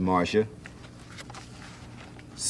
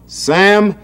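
higher, louder, slow yell; George yelling; 6 semitones higher, 6 dB louder, much slower than usual. A narrator's reading. Big brother George yells at Sam, raised voice. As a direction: snap at him to drop the book. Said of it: "yelled George".

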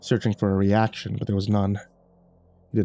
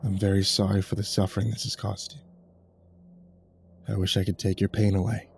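soft, weary apology; tired murmur; 4 dB quieter than usual. Acted. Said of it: "he murmured in that same tired tone".

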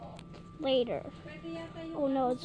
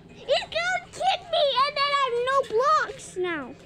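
panicked; 8 semitones higher, 5 dB louder than usual. Real, not acted. Panicking in game.

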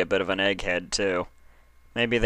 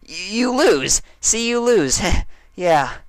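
very high, loud stammering cover-up with a nervous laugh; flustered Dash; 13 semitones higher, 6 dB louder, slower than usual. A narrator's reading. Dash covering her slip in front of Applejack, flustered.

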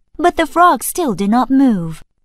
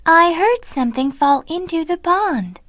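gentle sprite; 6 semitones higher than usual. Acted. Sprite answers lumberjack.

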